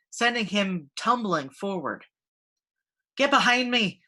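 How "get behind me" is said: ordering. A narrator's reading. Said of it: "Moira ordered".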